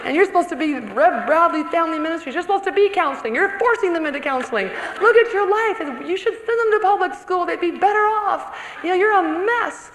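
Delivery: mock condemning rant; accusing inner voice. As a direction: berate her like a harsh critic. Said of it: "the voices in your ear that say".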